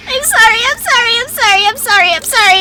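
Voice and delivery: high, frantic repeated sorry; panicked apology. Real, not acted. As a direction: squeal out sorries in horror. Cutting the princess's arm.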